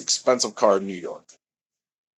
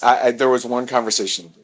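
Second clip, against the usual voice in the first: ordinary delivery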